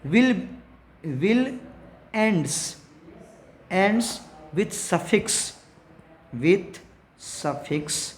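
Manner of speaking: very slow, drawn-out dictation. Shown as a demonstration. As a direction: dictate each word slowly for notes. Teacher dictating rule slowly.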